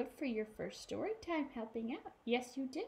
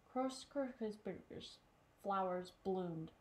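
usual speaking voice

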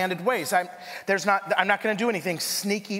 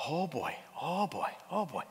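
uneasy murmur; 3 semitones lower, 9 dB quieter than usual. Retold or imitated.

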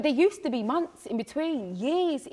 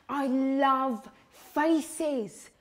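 ordinary talk

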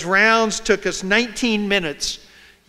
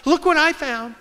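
high-pitched, excited imitation of an eager reporter; 7 semitones higher than usual. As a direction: call out a discovery, pitched well up. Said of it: "I went running over to the prosecutor".

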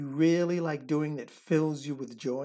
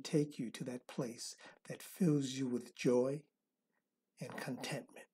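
soft spa whisper; 3 semitones lower, 8 dB quieter than usual. Acted. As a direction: guide them into calm, breath by breath. Leads a counted relaxation meditation.

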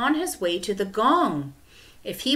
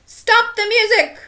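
high, very loud command; sudden shout; 10 dB louder than usual. A narrator's reading. Teacher halting the class's racket, sudden high shout. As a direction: shout over a noisy room.

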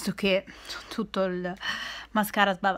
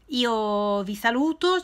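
usual speaking voice

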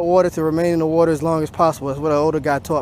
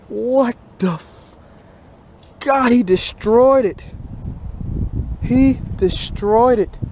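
anguished lament; 6 semitones higher, slower than usual. Real, not acted. Angler telling viewers the bass destroyed it, anguished lament. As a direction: mourn the fish that destroyed your lure.

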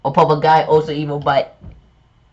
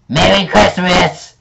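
evil Santa; 7 semitones higher, 4 dB louder than usual. Pretend.

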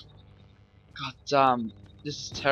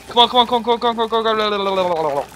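high, loud frantic repeats, fading a little; urgent pleading; 7 semitones higher, 4 dB louder, faster than usual. Real, not acted. Player fleeing the pursuer, frantic urgent chanting. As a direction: chant it desperately while fleeing. Urgent, being chased, willing escape.

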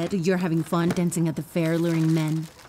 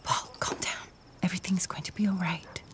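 soft, hushed comforting; reassuring whisper; 6 dB quieter than usual. Acted. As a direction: whisper to calm a worried father. Doctor calms the worried father.

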